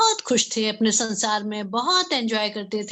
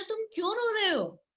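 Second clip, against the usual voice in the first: cat asking; 8 semitones higher, 5 dB quieter than usual. Acted. Cat asks the crying bird.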